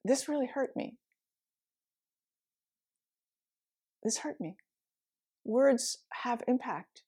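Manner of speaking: quiet, hurt and sincere; a touch of real hurt in a soft voice. Retold or imitated. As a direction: speak softly, letting the hurt show. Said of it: "and said".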